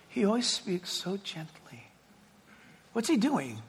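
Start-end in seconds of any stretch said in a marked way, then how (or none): 0.1-1.8 gently. Shown as a demonstration. Describing God's gentle voice.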